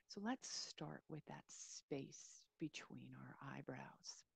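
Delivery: calm, unhurried meditation voice with long pauses; guided meditation. Real, not acted. Yoga teacher easing listeners into a body scan, guided meditation. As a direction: settle listeners gently into a body scan, leaving space between phrases.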